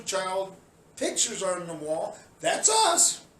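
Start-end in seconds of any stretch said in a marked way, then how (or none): 2.4-3.2 high, loud mocking of protesters; mock their boasting. Mocking protesters' voice, high and loud.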